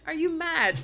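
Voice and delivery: ordinary talk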